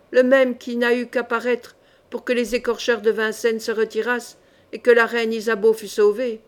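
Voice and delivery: plain reading voice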